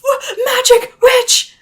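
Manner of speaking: high, crying shriek of a terrified sailor; panicked shriek. Acted. Terrified sailor shrieking at Zachary, high crying shout. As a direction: shriek "witch" in sheer panic. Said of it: "someone turns around and screams".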